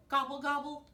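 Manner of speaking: high gobbling turkey, lightly grumbled; grumbling turkey. A narrator's reading. Turkey told he is too short, high mock-grumbled gobble. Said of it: "grumbled Turkey".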